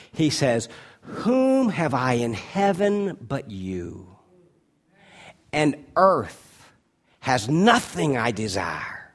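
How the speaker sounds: loud, ringing pulpit voice, slowing on the key words; fervent preaching. Acted. Pastor preaching to the church at full force.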